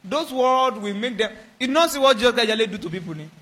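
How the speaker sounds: ordinary talk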